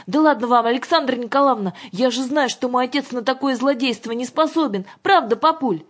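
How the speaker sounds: put-on high, loud childlike voice; confident young girl. A narrator's reading. Daughter vouches for her father to governess, confident. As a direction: reassure them in a high girlish voice.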